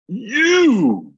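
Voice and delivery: single out one man from the line with a yell; loud call-out. Retold or imitated. Airport clerk calling out a traveler, sharp loud shout. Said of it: "this woman from the counter looks at me and goes".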